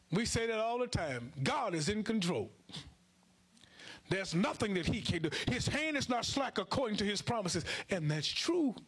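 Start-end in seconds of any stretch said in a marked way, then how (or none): none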